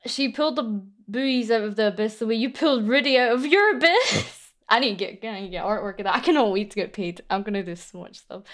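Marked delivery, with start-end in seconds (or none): none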